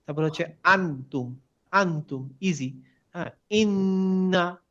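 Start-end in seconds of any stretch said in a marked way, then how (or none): 3.5-4.6 ইন্না drawn out slowly with a long doubled n; slow Arabic demo. Shown as a demonstration. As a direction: hold the ন্ন of ইন্না. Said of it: "এই তিনটা নিলেন". (ধীরে টেনে ইন্না)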